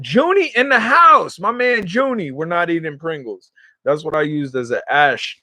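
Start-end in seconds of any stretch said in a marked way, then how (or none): 0.0-2.3 high, loud, stretched-out greeting; excited shout-out. Real, not acted. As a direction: hail an arriving friend in a high voice. Greets viewer joining the stream.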